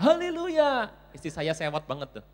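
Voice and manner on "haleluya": high, loud jubilant cry; child's shout. Acted. Child answering who ordered the chicken, jubilant shout. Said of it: "Anak saya teriak".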